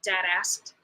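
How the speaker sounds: ordinary delivery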